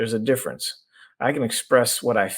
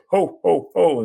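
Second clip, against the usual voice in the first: louder, higher jolly "Ho, ho, ho"; Santa ho ho ho; 7 semitones higher, 6 dB louder, slower than usual. Shown as a demonstration. Coach showing how tone changes meaning, jolly Santa laugh. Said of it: "associate it with Santa Claus".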